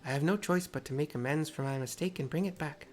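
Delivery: put-on Tepeke's mixed accent. Tepeke scolds the wandering girl in a mixed accent. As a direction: scold the child gently in a put-on foreign accent. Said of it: "the accent I've chosen".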